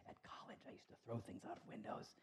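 confessional whisper. Real, not acted. Pastor confessing a college prank, whispered. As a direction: whisper it like a secret. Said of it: "but don't tell anybody".

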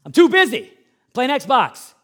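put-on loud impatient kid waving mom off; bratty brush-off. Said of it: "You think you're too busy".

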